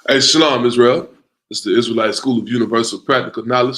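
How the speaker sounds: big booming hello to the room; loud greeting. Real, not acted. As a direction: call it out to everyone. Host opens the class greeting.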